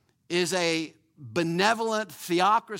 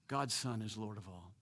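ordinary delivery

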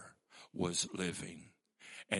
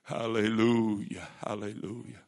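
preaching praise; 2 semitones higher, 7 dB louder than usual. Real, not acted. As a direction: call it out to the congregation. Preacher opens with shouted praise.

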